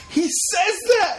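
high, drawn-out laughing shout; excited shout. Real, not acted. Co-host recognizing the Spider Monkey line, shouting with laughter. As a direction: yell it slowly in delighted disbelief. Delighted outburst over movie quote.

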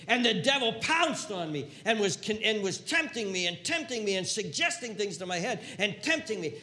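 loud, high, urgent; impassioned cry. Acted. Jesus telling the tempted he has been there, loud. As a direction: proclaim his own struggle with fire.

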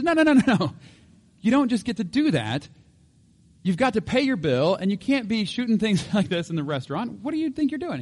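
high, agitated scolding; loud, exasperated objection, starting at a peak; indignant protest. Acted. Crowd scolds panda walking away.

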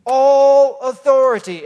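ALL punched high and loud, slow; proclaimed emphasis. Real, not acted. Preacher proclaims key phrase.

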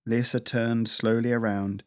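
ordinary delivery

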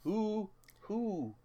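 high, loud mock whining; exasperated fake outrage; mock exasperated who. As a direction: whine who asked for this. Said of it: "I'm like".

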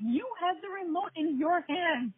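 high retold scolding; exasperated mother. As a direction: act out mom pointing out the obvious. Mother's exasperated reply acted out.

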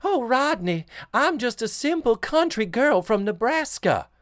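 high, loud, mock-innocent country girl; fake bashful aw shucks. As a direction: play a sweet naive farm girl. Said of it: "And then Dana Plato is".

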